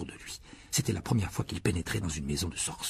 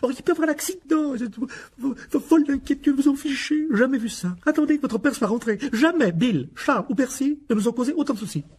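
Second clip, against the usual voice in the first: furious high-pitched mother's tirade; loud, shrieking angry rant; exploding rage; 6 dB louder than usual. A narrator's reading. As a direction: scold at the top of your voice. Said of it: "lorsque sa rage explosa".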